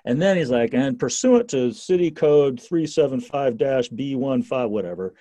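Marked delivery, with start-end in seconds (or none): 0.7-4.7 slow, pompous recital of city code; officious cop citing code. Retold or imitated. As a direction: read out the code number like a bored officer.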